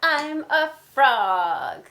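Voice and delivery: loud, high, slow proud claim; Piggy proudly. Acted. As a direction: announce it like big news. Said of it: "when I say the piggy parts".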